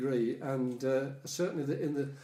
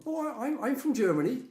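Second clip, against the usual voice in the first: imitating a German with an Irish accent, pitched higher; a friend's Irish lilt done higher; mock Irish accent on the answer; 9 semitones higher than usual. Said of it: "the most wonderful Irish accent".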